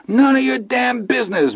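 very high shriek. Acted. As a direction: screech it out of control. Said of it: "Milo shrieked".

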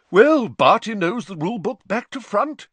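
high, loud, cheerful; beaming official. Acted. Bagman closing the matter, beaming. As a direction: chirp it loudly as if settled.

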